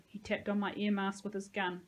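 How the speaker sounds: ordinary delivery, nothing marked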